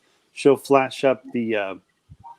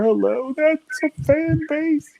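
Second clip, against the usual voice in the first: very high-pitched, put-on hype; jokey falsetto fan cheer; playful high voice. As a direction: squeal out a mock shout-out, held high. Mock shout-out, others laugh after.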